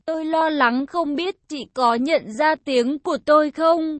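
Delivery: ordinary delivery, nothing marked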